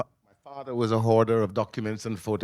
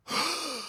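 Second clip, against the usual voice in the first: high gasp of sudden recognition; mother gasping as she recognizes her sons; shocked gasp; 9 dB quieter than usual. Retold or imitated. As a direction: catch your breath in shock. Mother realizes they're her sons.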